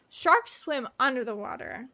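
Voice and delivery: normal talking voice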